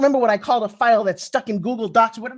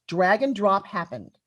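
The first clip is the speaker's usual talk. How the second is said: normal talking voice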